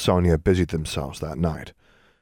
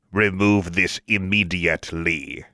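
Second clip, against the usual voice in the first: grating statue voice; slower than usual. A narrator's reading. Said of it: "in a low, grating voice".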